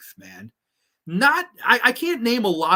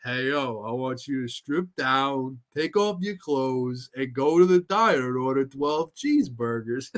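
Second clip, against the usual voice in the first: Stallone; 5 semitones lower than usual. Retold or imitated. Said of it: "imagine Stallone as Rusty Nail like that".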